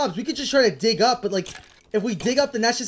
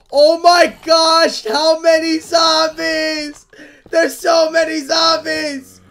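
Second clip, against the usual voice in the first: drawn-out, louder panicked cry; slow dismayed wail; 5 semitones higher, 6 dB louder, slower than usual. Real, not acted. Gamer overwhelmed by a zombie horde, wailing.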